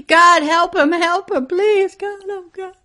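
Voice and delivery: high, loud, tearful pleading to God; crying prayer. Retold or imitated. Preacher reliving crying out to God in the vision. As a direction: sob out the plea. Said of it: "I just cry".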